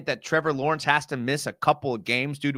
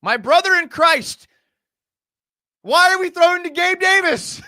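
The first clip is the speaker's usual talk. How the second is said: much higher, louder, cracking into a laugh; exasperated outburst; 8 dB louder than usual. Real, not acted. Streamer yelling at his quarterback's throw, exasperated. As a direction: throw up your hands at the play.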